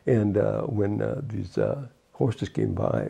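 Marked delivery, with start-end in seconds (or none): none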